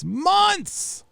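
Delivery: very high, squeaked exclamation; mock exasperation. Mock outburst echoing the message.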